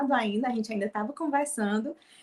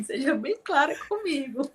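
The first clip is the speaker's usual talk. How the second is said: laughing plea; 5 semitones higher, 3 dB louder than usual. Retold or imitated. Repeats her plea laughing.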